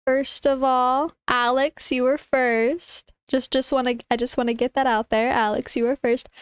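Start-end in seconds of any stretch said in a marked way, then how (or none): none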